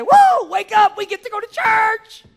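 put-on very high, loud whoop to wake the kids; excited wake-up call. Dad waking his kids for Sunday church, exuberant whoop. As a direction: sing it out joyfully.

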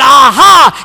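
loud, high triumphant exclamation; gloating neighbor. Retold or imitated. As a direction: pounce like you caught her out. Neighbor springs his trap.

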